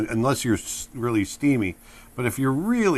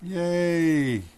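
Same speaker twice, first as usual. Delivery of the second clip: happy cheer; 6 semitones higher than usual. Acted.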